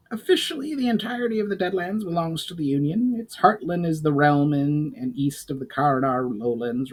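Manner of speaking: goblin voice. Acted.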